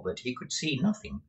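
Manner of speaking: ordinary delivery, nothing marked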